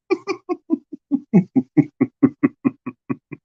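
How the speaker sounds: laugh. Real, not acted. Laughing at the Kiwi joke.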